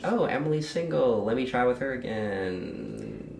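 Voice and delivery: nonchalant. Retold or imitated. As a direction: toss it off like a passing idea. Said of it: "like, some nonchalant".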